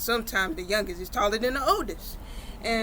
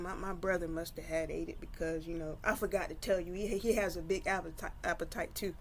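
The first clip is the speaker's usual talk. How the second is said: hushed whisper; 3 semitones lower, 10 dB quieter than usual. Acted. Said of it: "whispered to Alexa".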